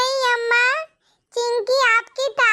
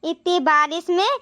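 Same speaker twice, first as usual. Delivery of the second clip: put-on much lower, gentle elderly woman's voice; a caring granny soothing two wet girls; kind old woman; 5 semitones lower than usual. Old woman inviting the crying girls home, gentle and low.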